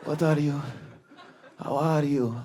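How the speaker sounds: put-on old Lebanese man. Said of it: "seventy years old, Lebanese man".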